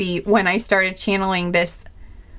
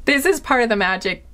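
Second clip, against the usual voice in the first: normal talking voice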